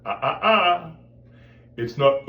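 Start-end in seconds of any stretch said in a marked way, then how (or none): 0.0-0.9 put-on high, loud "ah-ah-ah", scolding a child; glottal strike. Shown as a demonstration. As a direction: tut a naughty child with glottal ahs. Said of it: "like you're scolding a child".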